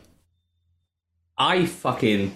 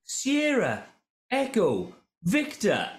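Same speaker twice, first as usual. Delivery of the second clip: mock patient spelling; 10 semitones higher, much slower than usual. Spelling name phonetically to receptionist.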